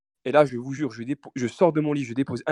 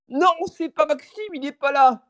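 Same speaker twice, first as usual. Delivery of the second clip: high, slow put-on old man voice; mock old man answering the door; 12 semitones higher, 4 dB louder than usual. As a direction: fake being an old man. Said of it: "j'imite une voix de vieux".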